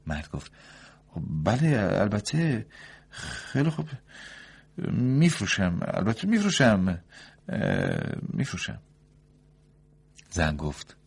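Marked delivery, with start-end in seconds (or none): 1.1-8.8 higher, louder doctor conceding; the doctor agrees to sell, flustered; doctor's voice. A narrator's reading. Doctor gives in.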